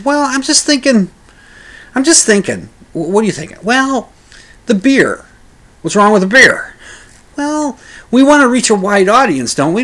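hesitant high-pitched reply; high Bob voice. Retold or imitated. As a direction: pipe up with a reluctant yes. Bob voiced in retold story.

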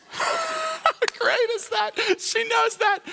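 high, slow, crying laugh; overjoyed preacher laughing; laughing delight. Real, not acted.

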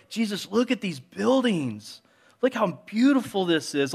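high, eager, gushing praise of the temple; excited disciples. Retold or imitated. Disciples trying to lift Jesus' mood, high and gushing. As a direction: cheer Jesus up with wide-eyed enthusiasm. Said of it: "they're like".

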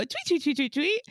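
bird tweets. Retold or imitated. [name] imitates her old phone's tweeting ringtone. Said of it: "you go".

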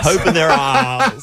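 slow, high mock-posh accent with a laugh. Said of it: "Where does that voice come from?".

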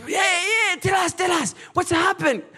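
high, loud shouted urging; high, loud eager group shout; eager crowd urging. Retold or imitated. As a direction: call out eagerly for the story.

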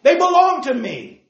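preacher booming out the Lord's claim; proclaiming Jesus' words. Retold or imitated. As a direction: declare it like a proud shepherd naming his flock. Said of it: "He says".

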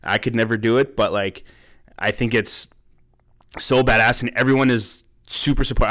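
ordinary delivery

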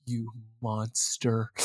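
soft, slow, breathy accusation; a low appalled hush; hushed mock horror. Mother recoiling from her son, hushed and slow. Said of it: "she's like".

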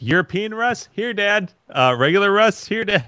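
high, lightly voiced dad-call; Chevy Chase impression. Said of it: "Chevy Chase is like".

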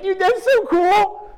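high, loud, slow dude-voice; delighted. Retold or imitated. Preacher answering his teacher, high and delighted. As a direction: gush like a kid.

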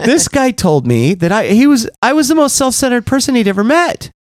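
high, wounded complaint rising as it goes; imitated near tears. Husband telling wife about sponsor, high mock-tearful complaint. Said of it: "I was almost in tears".

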